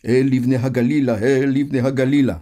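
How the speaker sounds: loud, high rhythmic chant; shouting. A narrator's reading. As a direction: shout it out like a rallying song. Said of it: "והוא מקפץ ומתנועע וצועק".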